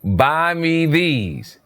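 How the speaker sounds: mock demand, the word these stretched very slowly; mock blunt demand. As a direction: imitate her flat ask, drawn out. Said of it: "she said".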